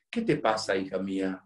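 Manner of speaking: low, gentle fatherly question; tender concern. Retold or imitated. Priest asks the silent girl at confession, tender concern. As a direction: ask it softly and low, with care.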